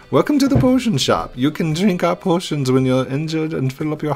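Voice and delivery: high chirpy shopkeeper calling out; potion seller voice. Acted. Voices the potion shop lady.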